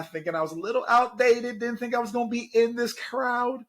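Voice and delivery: high, put-on fretful voice; self-mocking worry about being outdated; mock worried. Real, not acted. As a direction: play up your nerves for laughs. Self-mocking aside, strongly shifted.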